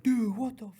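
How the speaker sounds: high, slow mock buddy voice; lightly voiced stunned buddy; imitating buddy. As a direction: play the shocked friend. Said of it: "he was like".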